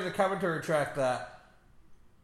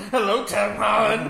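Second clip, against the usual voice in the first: flirty laughing hello; 3 semitones higher, 8 dB louder than usual. Pretend. Hosts jokingly flirting with actor.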